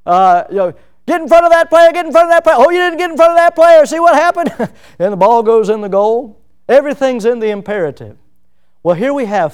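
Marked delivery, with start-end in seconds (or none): 1.1-4.5 loud, high sideline yelling at a player; soccer coach. Retold or imitated. Preacher imitates a soccer coach yelling at a player. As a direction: bark orders like a coach on the field. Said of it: "listen to soccer coaches out on the field".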